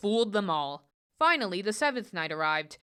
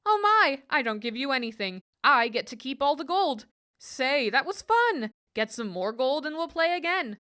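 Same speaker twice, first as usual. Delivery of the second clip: lightly voiced, higher, delighted trickster; gleeful Herschel; 6 semitones higher, 3 dB louder than usual. Herschel gloating to the goblin over winning the gold. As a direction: crow over the win in a high bright voice.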